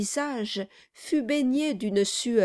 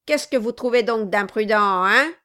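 sharp challenge; 2 semitones higher, 7 dB louder than usual. Acted. Quilp challenges Brass.

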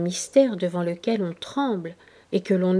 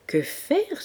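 exclamation; 8 semitones higher than usual. A narrator's reading. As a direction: question exclamée, nettement plus aiguë. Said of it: "s'écria Chancerelle". (s'écrier, exclamatif)

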